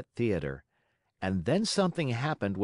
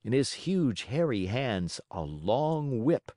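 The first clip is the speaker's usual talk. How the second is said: ordinary delivery, nothing marked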